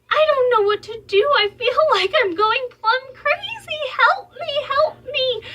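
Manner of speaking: high, loud, frantic mock meltdown; desperate plea. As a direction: act out an overwhelmed cry for help. Acts out desperate prayer for help.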